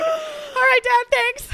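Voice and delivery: high, loud, flustered; awkward high shout. Retold or imitated. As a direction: yelp it out awkwardly. Awkward reply to dad.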